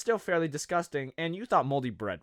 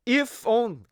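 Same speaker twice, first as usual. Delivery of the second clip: big theatrical if only, higher and louder; dramatic sigh; 8 semitones higher, 5 dB louder than usual. Pretend. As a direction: wail it like a tragic ad. Dramatic lament over mustard mess.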